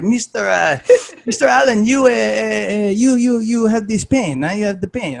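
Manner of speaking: mock the old coach's thick European accent, stammering. Coach telling the injured speaker, in thick European accent. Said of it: "he's got this thick European accent".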